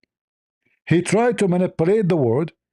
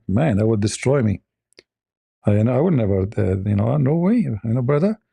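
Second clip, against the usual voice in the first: ordinary talk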